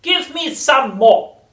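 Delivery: high, loud, slow screechy chant; sing-song triumph of a crazed old crone; shouting old grandma. A narrator's reading. Grandma rising through the house, shrieking singsong. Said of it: "she cried".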